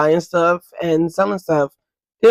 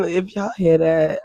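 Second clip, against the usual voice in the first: ordinary delivery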